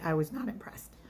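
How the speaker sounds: whispered, unimpressed aside; whispered verdict. Real, not acted. Judging the date in an aside.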